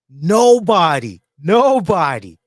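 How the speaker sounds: "Nobody" drawn out loud and emphatic; stress on "Nobody". Real, not acted. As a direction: hammer "Nobody" slowly and loudly. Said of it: "emphasize that".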